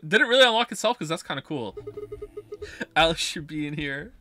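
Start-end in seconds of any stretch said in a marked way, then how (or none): 1.8-2.9 high breathy laugh. Real, not acted. Laughing at the unlock.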